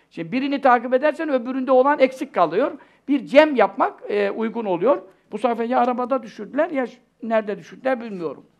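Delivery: usual speaking voice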